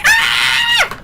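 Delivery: very high, loud squealing scream; excited fan scream. Retold or imitated. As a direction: shriek at the top of the range. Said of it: "I'm like".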